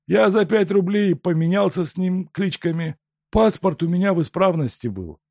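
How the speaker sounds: high, loud plaintive peasant; a downtrodden peasant pleading, high; humble peasant. Acted. Peasant continues story.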